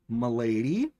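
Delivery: low, soft mock-gallant voice; fedora nice guy. Mimics fedora-wearing nice guys.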